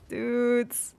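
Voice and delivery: ordinary talk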